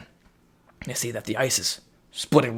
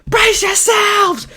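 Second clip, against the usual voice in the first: mock panicked shout; 11 dB louder, slower than usual.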